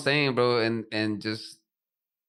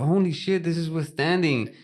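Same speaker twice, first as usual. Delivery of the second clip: retold amazement, pitched up high; mock astonished exclamation in a higher voice; amazed; 6 semitones higher than usual. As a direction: recreate a stunned reaction, higher than usual. Retelling their amazed reaction to fabric.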